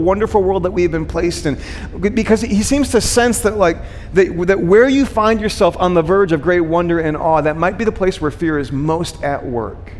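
usual speaking voice

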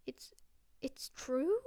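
halting, shaken question; faltering. A narrator's reading. McGonagall to Dumbledore, faltering in shocked disbelief. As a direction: stumble over it in disbelief. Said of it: "faltered Professor McGonagall".

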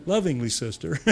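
lovingly said through a laugh. Real, not acted. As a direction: chuckle through "lovingly, sister".

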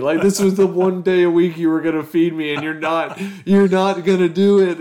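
put-on high, loud childish whine; whiny kid voice. Kid whining about not being fed.

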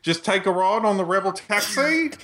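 hammed-up Australian impression; Australian accent. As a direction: put on a broad fake Australian twang. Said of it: "rest of the podcast like this".

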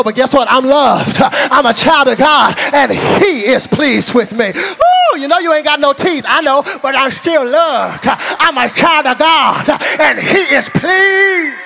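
loud, exultant sermon with stretched key words; full-force preaching. Real, not acted. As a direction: build to a shouted, drawn-out finish.

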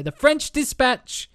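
high, slow title announcement; slow lifted title; grand title reveal. Real, not acted.

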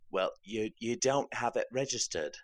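put-on British accent. Cop telling driver about expired registration, imitated British accent. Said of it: "he had the British accent".

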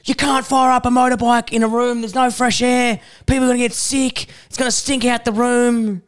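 high whiny protest; whiny complaining voice. Retold or imitated. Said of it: "he's like".

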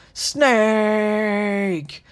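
long, high drawn-out cry; hammed-up video game death scream; shouted safe word. A narrator's reading. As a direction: stretch the name into one long wail. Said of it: "he was shouting his safe word".

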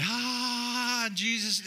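high, mild pleading cry to God; a lightly voiced plea over a screaming baby. Tired father lightly begging Jesus for sleep. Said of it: "beg of her".

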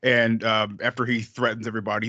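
normal talking voice

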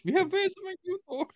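high, mocking German accent. Host quoting a German character Florian, mock German accent. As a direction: ham up a silly German accent. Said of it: "'Cause this is Florian".

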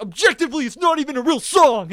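high, loud, emphatic ruling; mock outrage. Friend condemning the song, mock outrage. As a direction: imitate a friend loudly dismissing the song. Retold quarrel over song.

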